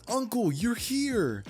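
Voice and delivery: high, drawn-out joyful cry; excited greeting. Acted. Girl joyfully greets her uncle.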